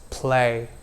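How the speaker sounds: slow, careful Next is play; slow model word. Shown as a demonstration. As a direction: model the word slowly for repeating. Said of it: "repeat these words after me".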